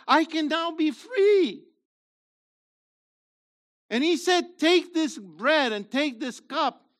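loud, high, slow preaching build; rising pulpit fervour; fervent preaching. Acted. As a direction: preach it out to the congregation. Preaching at full force.

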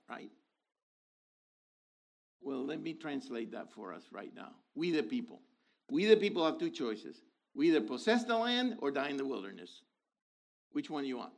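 ordinary delivery

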